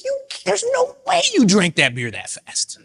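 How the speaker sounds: high, disbelieving squeak; can't believe how fast he drank it; incredulous. Retold or imitated. Bartender to customer, high and incredulous. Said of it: "And I'm like".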